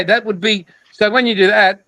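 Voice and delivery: normal talking voice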